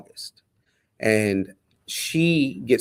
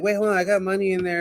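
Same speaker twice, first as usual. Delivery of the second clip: quick, higher-pitched stunned protest; retold disbelief, rushed and raised in pitch; 7 semitones higher, 4 dB quieter, faster than usual. Man at commissary told his money is gone, stunned. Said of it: "I'm, like, stunned".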